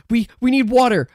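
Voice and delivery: mock panicked call for water; urgent. Guests beg the guard for water, urgent. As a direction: plead urgently. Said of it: "they're like".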